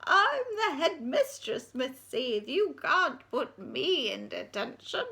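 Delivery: put-on high, quavering old lady asks; old headmistress inquiring. Old head replying.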